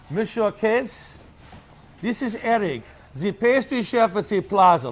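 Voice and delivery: put-on French accent. Retold French chef phone call.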